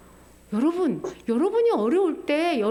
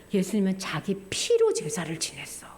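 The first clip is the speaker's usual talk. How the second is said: hushed whisper; 7 semitones lower, 4 dB quieter than usual. Real, not acted. Preacher revealing Jesus' blood sacrifice in a whisper. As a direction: whisper it like a secret.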